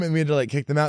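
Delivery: usual speaking voice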